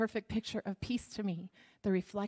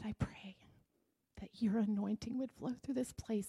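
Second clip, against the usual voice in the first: soft, hushed prayer voice; 4 dB quieter than usual. Real, not acted. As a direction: pray quietly over the people. Leads the congregation in prayer.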